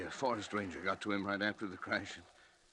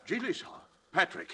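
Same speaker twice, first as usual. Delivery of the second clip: higher, alarmed disbelief; shocked; 11 semitones higher than usual. Acted. Colleague horrified at Patrick's plan, shocked disbelief. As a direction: gasp it out, horrified.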